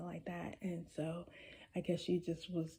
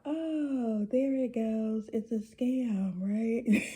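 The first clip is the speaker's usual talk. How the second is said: higher and louder, mock-knowing scam alarm; slow, loud "it's a scam" aside to the audience; knowing suspicion; 4 semitones higher, 7 dB louder, much slower than usual. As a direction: call it out like you've seen this con before. Suspects the woman of a scam.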